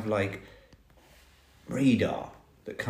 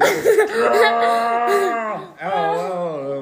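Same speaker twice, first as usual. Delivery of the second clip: laughing ow; 7 semitones higher, 5 dB louder, much slower than usual. Real, not acted. Thumb poked, laughing.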